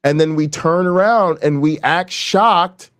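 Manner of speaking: ordinary talk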